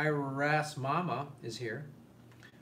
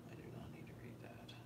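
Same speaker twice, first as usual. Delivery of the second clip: whisper; 20 dB quieter, much faster than usual. Real, not acted. Aside while reading chat.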